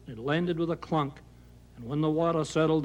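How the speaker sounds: ordinary delivery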